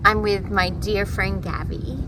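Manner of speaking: ordinary delivery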